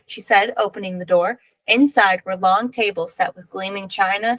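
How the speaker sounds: plain reading voice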